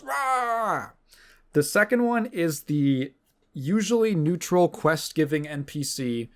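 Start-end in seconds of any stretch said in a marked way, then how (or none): 0.0-0.9 a mock war cry, pitched way up; enemy battle cry. Enemy charging the player, a mock battle cry. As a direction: charge in with a silly yell. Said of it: "the enemy who charges at you and goes".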